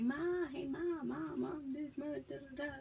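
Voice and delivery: put-on high, insistent kid-style calling; calling for mom. Mimicking herself calling her stepmom, high and insistent. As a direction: pester her with repeated high calls.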